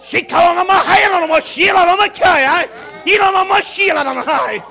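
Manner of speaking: loud, high shouted praise; ecstatic preaching. Real, not acted. Preacher caught up in worship before the church, shouting. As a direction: cry out in the spirit.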